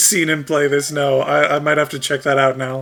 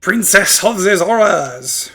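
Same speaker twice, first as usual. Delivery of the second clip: put-on French-accented Ruto; 5 semitones higher, 4 dB louder, slower than usual. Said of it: "made Ruto French".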